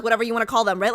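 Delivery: usual speaking voice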